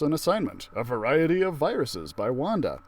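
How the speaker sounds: ordinary delivery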